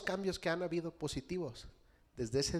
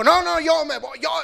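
put-on much higher, loud teenage refusal; defiant son; 13 semitones higher, 16 dB louder than usual. Pastor mimics son refusing his father, high and loud. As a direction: dig in and refuse like a stubborn boy. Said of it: "Y me dijo".

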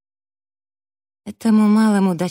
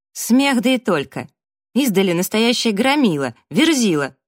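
raised, scornful jeering; haughty taunt; 5 semitones higher, 4 dB louder than usual. A narrator's reading. Provoking the man in the bushes, haughty and raised. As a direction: jeer in a high, carrying voice. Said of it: "высокомерно".

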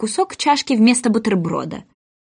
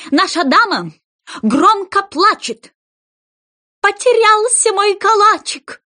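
shrill proclamation; 10 semitones higher, 3 dB louder than usual. Acted. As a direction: declaim the verse like a crier. Rabbit reads the indictment.

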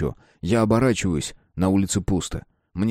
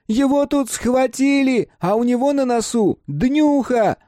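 put-on high, slow raised boyish yell; shouting; 3 dB louder, slower than usual. A narrator's reading. Mark yelling about Simon's birthday, high and slow. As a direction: yell it out slowly. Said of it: "Крики Марка".

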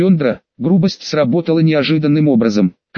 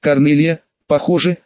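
plain reading voice

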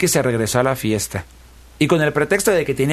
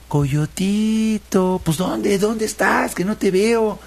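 high, drawn-out playful calling; teasing moon voice; 9 semitones higher, much slower than usual. A narrator's reading. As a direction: coo his name slowly, teasing. (voz aguda y juguetona de la luna)

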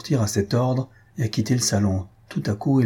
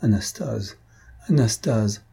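distressed; slower than usual. A narrator's reading. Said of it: "d'une voix pleine de détresse".

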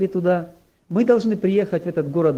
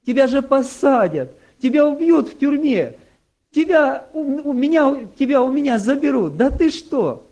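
higher, louder panicked pleading; frantic mother; 6 semitones higher, 3 dB louder than usual. Retold or imitated. Mother begging her son to take the oath, panicked. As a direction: beg him as if losing him.

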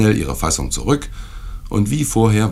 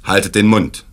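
sharp, louder command; angry shout; 4 semitones higher, 7 dB louder than usual. A narrator's reading. Host silences the rowdy guests, angry shout. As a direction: snap the table into silence. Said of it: "rief mein Gastgeber aufgebracht".

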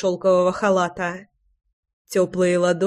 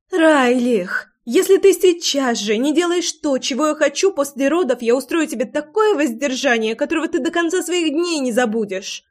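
high, insistent threat, only slightly raised in volume; mild shouting; 6 semitones higher than usual. A narrator's reading. Лой threatens her lover with abstinence, a high mild shout. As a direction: scold him in a high, urgent outcry. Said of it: "закричала Лой".

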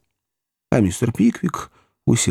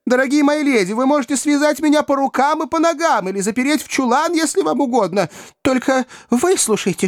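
high, urgent begging; desperate pleading; 13 semitones higher than usual. A narrator's reading. Pickwick begs the ladies to hear him out, desperate.

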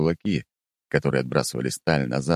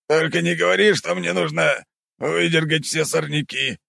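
hope it isn't weeding; hopeful; 12 semitones higher than usual. Acted. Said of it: "с надеждой в голосе".

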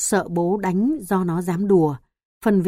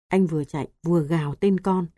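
ordinary delivery

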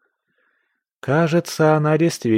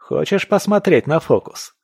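higher, playful question; cocky, lightly amused offer; amused smirk; 7 semitones higher, 3 dB louder than usual. A narrator's reading. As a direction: tease a friend with a trick. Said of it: "хмыкнул Илья в ответ".

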